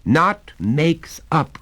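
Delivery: put-on the boy insisting hard; crying out. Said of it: "cried the boy".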